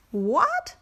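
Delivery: startled yelp of disbelief; shocked rabbit cry; cried out. A narrator's reading. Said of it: "cried Mister Rabbit".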